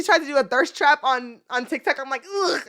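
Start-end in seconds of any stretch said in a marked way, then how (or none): 2.2-2.6 high, soft groan of revulsion; disgusted ugh. Retold or imitated. Viewer swiping past a thirst trap, disgusted.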